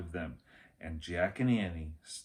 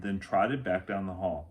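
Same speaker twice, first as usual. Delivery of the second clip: ordinary delivery, nothing marked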